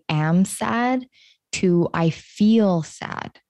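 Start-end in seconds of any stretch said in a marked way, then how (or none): 1.9-3.4 "feel" slowed and leaned on; slow, weighted "I feel sad"; stress on feel. Shown as a demonstration. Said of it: "went from saying".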